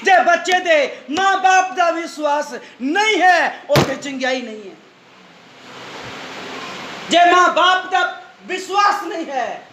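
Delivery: high, ringing shouts in measured phrases; full-force preaching. Real, not acted. (ਪੂਰੇ ਜੋਸ਼ ਨਾਲ ਉੱਚੀ ਸੁਰ ਵਿੱਚ ਪ੍ਰਚਾਰ)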